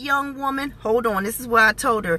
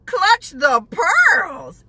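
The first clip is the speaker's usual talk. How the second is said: very slow, higher theatrical shock; hammed-up pearl-clutching gasp of words; mock scandalized; 6 semitones higher, much slower than usual. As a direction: draw it out in mock horror. Mock shock at clerk's reply.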